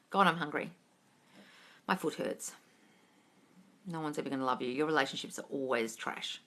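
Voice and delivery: low, very soft self-critical muttering; muttered inner voice. Acted. Coach acts out thoughts during silent sitting, low inner voice. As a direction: drop low and quiet, like a thought creeping in.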